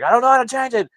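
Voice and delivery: high, loud flustered yell; panicked retelling. Retold or imitated. Family unable to change the GPS language, panicked. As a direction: act out helpless panic at full volume.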